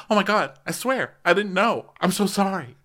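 high, earnest-sounding apology; mock gushing apology. Acting out Mary's gushing apology.

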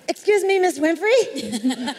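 a fan hailing a star before she leaves; calling out. Retold or imitated. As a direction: call up the stairs to stop her. Calling after Oprah on stairs.

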